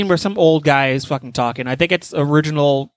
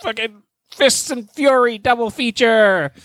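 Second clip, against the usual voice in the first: announcer title; 8 semitones higher than usual. Shown as a demonstration. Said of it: "coming up with this fucking gimmick".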